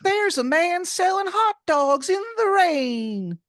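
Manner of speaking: put-on squeaky, high, slow caricature of a Philadelphia accent; terrible Philly accent. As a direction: grate it out in an over-the-top Philly accent. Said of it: "put on that accent for no reason".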